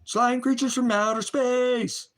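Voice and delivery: high, slow, drawn-out sci-fi announcer parody; spooky mock voice. Preacher mocking people who call the Father an alien, spooky high voice. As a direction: ham it up like a cheesy alien movie.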